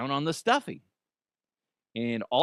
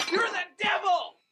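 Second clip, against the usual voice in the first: high, shouted mock accusation; mock accusing shout. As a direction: shout it like a horrified mother. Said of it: "immediately go".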